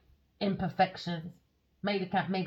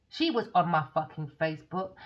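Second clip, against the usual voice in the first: normal talking voice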